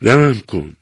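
a narrated desperate cry. A narrator's reading. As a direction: cry out to her for mercy. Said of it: "فریاد زد".

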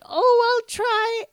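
put-on high, sweet creepy old lady; creepy old woman voice. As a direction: coo like a spooky old woman. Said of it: "who did the whole creepy voice".

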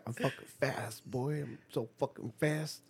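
soft, breathy mock bragging kid; hush-voiced imitation of a proud running child; mock kid boast. Friend imitates a graduating kid bragging, softly. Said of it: "He was like".

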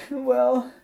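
high, loud mock sobbing; hammed-up wail of a crying girl; imitated crying. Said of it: "And she started crying".

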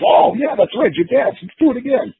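high, mock Dory voice; recalling the match, a lightly hammed-up high Dory voice; Dory imitated.

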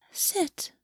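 a hushed, coaxing command; soft. A narrator's reading. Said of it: "she said softly".

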